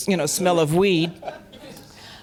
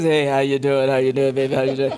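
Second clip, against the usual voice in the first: low, easy greeting as [name]; [name]; 6 semitones lower than usual. Retold or imitated. [name] welcoming the young singer, a low laid-back hello.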